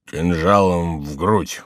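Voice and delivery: slow, thick murmur; slow, lowish drunken mumble; drunken muttering. A narrator's reading. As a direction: mutter it slowly as a tipsy monk. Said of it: "бормотал Горанфло".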